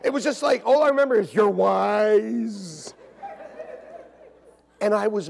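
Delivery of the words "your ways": loud, long-stretched Southern "ways"; mock Southern accent. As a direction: drag "ways" out in a hammed-up Southern twang. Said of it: "Southern accent".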